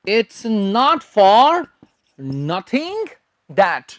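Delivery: very slow, word by word; slow dictation. Shown as a demonstration. Teacher dictates the phrase slowly for note-taking. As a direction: spell it out slowly for students to write. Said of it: "तो बनाए sentence".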